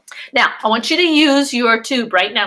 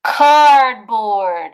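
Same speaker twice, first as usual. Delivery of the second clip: loud answer; 6 dB louder than usual. Shown as a demonstration. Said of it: "what is it made out of?".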